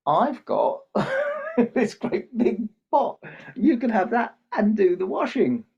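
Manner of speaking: put-on loud, high-pitched boy bursting with a solution; excited offer. Boy offers washman his pot, loudly delighted. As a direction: laugh through it, stretching the big words.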